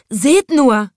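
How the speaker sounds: high, loud frightened cry; trembling crowd. A narrator's reading. Townsfolk crying out in fear at the lion. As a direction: panic at the roaring lion. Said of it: "sagten die Leute zitternd".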